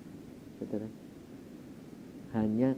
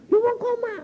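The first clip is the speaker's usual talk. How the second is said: very high, loud objection; hammed-up incredulous retort; acted protest; 6 dB louder than usual. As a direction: imitate an exasperated husband squealing. Retold husband protests.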